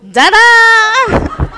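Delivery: high, loud laughing "Da-da!"; toddler's ta-da. Retold or imitated. Grandmother imitates grandson showing off in sink, high and loud. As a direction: announce it like a proud little boy in the sink. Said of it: "and he goes".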